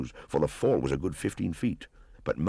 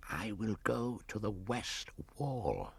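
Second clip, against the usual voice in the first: snake's whisper; 6 semitones higher, 9 dB quieter, slower than usual. Acted. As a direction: hush it like a plotting python. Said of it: "Kaa whispered".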